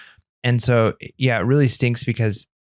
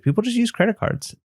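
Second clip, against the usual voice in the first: wife imitated in a much higher voice; imitating wife; 11 semitones higher than usual. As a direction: quote her, lifting the pitch well up. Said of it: "She's like".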